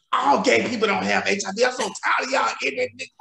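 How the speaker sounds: high, fast, sassy scolding; mock-scold him like she's had it; friend cussing out husband. Friend mocks husband's gossip, high, fast and sassy.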